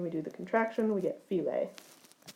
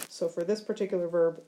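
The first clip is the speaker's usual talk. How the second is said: ordinary talk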